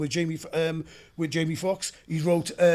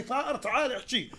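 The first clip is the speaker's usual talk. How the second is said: mimicking classmates through a laugh, pitched up; laughing mimicry; 7 semitones higher than usual. Retelling Iraqi teachers mocking his Welsh accent, laughing. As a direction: tease it while laughing. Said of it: "They're all laughing".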